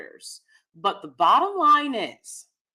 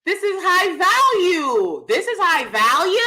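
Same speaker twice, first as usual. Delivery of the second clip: mocking the high value man; 7 semitones higher, 4 dB louder, slower than usual. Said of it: "great value guy".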